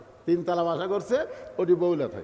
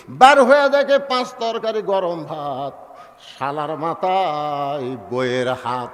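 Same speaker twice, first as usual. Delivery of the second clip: slow rhyme; 3 semitones lower, 4 dB louder, slower than usual. Acted. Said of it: "rhymed refrain".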